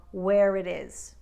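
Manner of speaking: "where it is" slowly, R carried into "it"; linking R in "where it". Shown as a demonstration. Coach giving an example of pronounced R's, linking R in "where it is". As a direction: sound the R of "where" straight into "it". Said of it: "when one word ends with an R".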